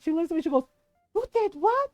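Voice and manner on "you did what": high-pitched, incredulous auntie; mock outrage, drawing out the accusation; retold indignant disbelief. Tita reacts to being told on.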